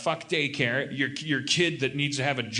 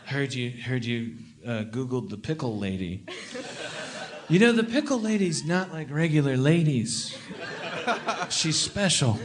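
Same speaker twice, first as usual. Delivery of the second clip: hesitant, gentle dad voice; fumbling to explain gently; awkward dad talk. Retold or imitated. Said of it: "I'm gonna come sit on their bed and go".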